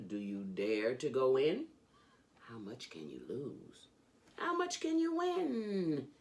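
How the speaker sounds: ordinary delivery, nothing marked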